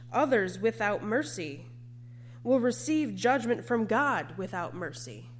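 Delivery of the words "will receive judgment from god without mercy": usual speaking voice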